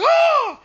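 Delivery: high, loud shriek; terrified scream. Retold or imitated. Boy shrieking at the eyeless ghost. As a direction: scream at her empty eyes. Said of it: "I'm like".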